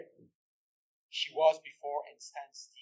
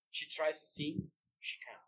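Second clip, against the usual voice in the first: ordinary talk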